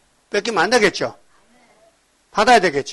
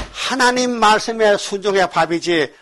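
ordinary talk

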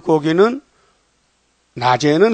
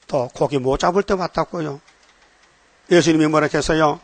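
ordinary talk

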